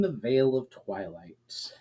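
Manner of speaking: slow breathy whisper of the chapter title; dramatic whisper. Acted. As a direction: whisper the title like a secret, slowly. Dramatic chapter title after intro.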